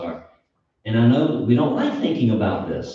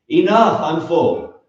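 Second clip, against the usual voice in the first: louder, higher exclamation, imagining the grave refusing more; lightly voiced grave; 6 semitones higher, 5 dB louder than usual. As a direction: call it out as the grave's imagined cry. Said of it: "the grave is never going to ever say".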